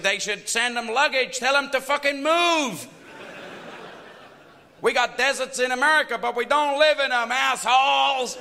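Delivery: high, loud ranting shout; Sam Kinison impression. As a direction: rant it slowly with rising fury. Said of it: "he said".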